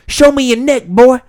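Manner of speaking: high, loud imitation of a street heckler; yelling homeless man. Storyteller mimicking the drunk guy's line, high yelling. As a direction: holler it as a mock threat. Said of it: "he was yelling at me".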